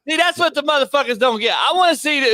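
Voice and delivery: high-pitched, worked-up complaint; indignant rant. Real, not acted. Real indignant rant about harassed fans.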